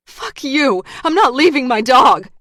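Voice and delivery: furious, desperate yelling; angry shout. A narrator's reading. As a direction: scream it in defiance. Lara refuses at gunpoint.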